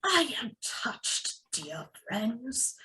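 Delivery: almost too moved to speak; choked whisper. Acted. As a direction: Bilbo touched, whispering to friends. Bilbo moved.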